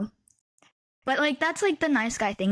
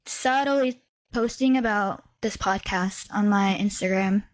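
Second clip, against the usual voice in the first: ordinary delivery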